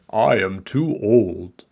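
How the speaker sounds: slow, lightly voiced old butcher. A narrator's reading. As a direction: drag it out as a tired excuse. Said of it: "The butcher said".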